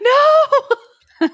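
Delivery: very high, laughing wail of protest; dismayed wail. Real, not acted. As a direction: wail in playful heartbreak. Protests dog tied in sack.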